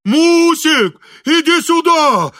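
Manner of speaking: very high, loud panicked call; frightened scream. A narrator's reading. Frightened engineer calling his wife over, screaming. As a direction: scream for help, stretching it out. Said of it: "закричал испуганный инженер".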